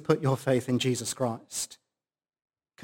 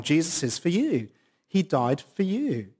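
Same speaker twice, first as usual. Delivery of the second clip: ordinary delivery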